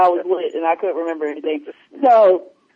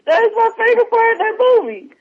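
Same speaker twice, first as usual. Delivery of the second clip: excited; 11 semitones higher than usual. Real, not acted. Laughing, delighted about scene.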